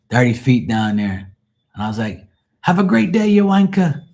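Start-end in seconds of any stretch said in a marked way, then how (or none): 2.6-4.0 put-on high, loud English-accented heckle; mock English accent. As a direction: call out teasingly in fake English. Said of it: "You could pass for an Englishman".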